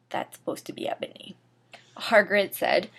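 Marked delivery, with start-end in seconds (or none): 0.1-1.4 soft whispered correction; a quiet muttered correction to the listener; whispered aside. Real, not acted. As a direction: lean in and whisper the fix. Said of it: "aside correcting the text".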